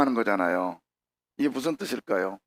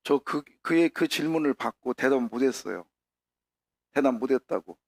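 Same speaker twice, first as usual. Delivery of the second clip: normal talking voice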